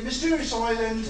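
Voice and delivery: normal talking voice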